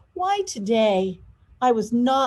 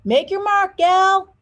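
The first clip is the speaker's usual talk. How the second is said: a loud, higher farewell call from the truck; 9 semitones higher, 6 dB louder than usual. Acted. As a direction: shout it as he drives off. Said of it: "calling out".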